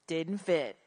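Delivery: low, flat letdown after a sigh; deflated. Shown as a demonstration. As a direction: drop it low and let it sink. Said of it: "Use your breath for your dramatic pause".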